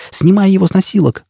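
высокий резкий приказ; прокричать команду в панике; крик. A narrator's reading. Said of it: "закричала Джизбелла". (крик-приказ)

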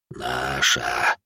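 low, soft, rustling creature voice; ghoul objecting. A narrator's reading. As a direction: stretch it out in a laboured ghoul whisper. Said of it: "возразил Гуль". (шелестящее возражение гуля, низко и протяжно)

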